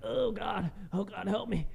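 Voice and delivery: hammed-up pleading in pain; mock desperate plea. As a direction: act out begging for help. Retold plea while injured.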